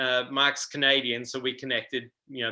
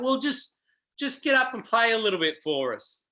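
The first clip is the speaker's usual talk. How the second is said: coaxing; 9 semitones higher than usual. Retold or imitated. Quoting women urging him to play.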